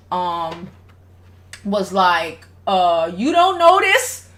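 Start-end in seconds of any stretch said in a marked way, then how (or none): 3.1-4.3 high, slow yelling dad; the dad snapping at his wife in disbelief. Retold or imitated. Father challenging his wife in the argument, yelling.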